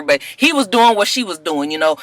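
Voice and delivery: ordinary delivery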